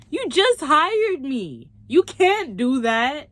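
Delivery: high, loud, drawn-out objection; slow, indignant whine at the boss; exasperated protest. Retold or imitated. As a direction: stretch each word out in loud disbelief.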